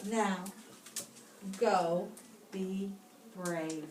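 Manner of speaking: ordinary delivery, nothing marked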